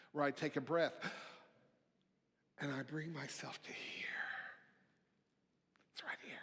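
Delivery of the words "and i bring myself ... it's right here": breathy whisper, drawn inward. Acted. Sermon, pastor whispering to bring listeners back to now. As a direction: hush them into the present moment. Guiding into a sacred pause.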